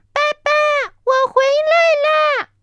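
put-on high, loud, slow child's call; little dinosaur running home, calling dad; calling from afar. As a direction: shout it across the valley to dad. Baby calls from far away.

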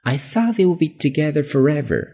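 high, wistful voice; disappointed surprise. Acted. Teacher playing a fan dismayed about the couple. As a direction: let the sentence sound let down. Acting out dismay over the split.

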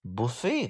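"buffet" as buh-FAY, no t; "buffet" ending in a stressed "fay"; French-style buffet. Shown as a demonstration. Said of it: "是个法语词".

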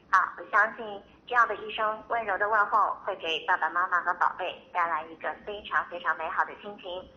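normal talking voice

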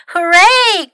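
high, loud cheer; joyful cry. A narrator's reading. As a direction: cheer out a victory. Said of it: "cried Fox".

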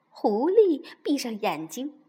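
usual speaking voice

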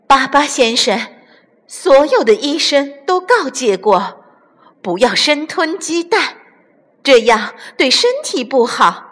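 high, earnest admonishing; high-voiced hen giving advice; mother hen negotiating. A narrator's reading.

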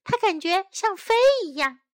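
ordinary delivery

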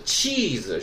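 气子 as "chiizu", first vowel held long. Shown as a demonstration. Teacher explaining the cheese word, 气子 with a long drawn-out "chii". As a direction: draw out the "chii" of 气子, then a short "zu". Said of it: "没有长音".

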